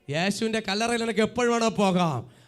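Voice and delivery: loud, driving sermon delivery; forceful preaching. Real, not acted. Preacher assures believers God does not frighten them, forceful preaching. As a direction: pound out each phrase to the congregation.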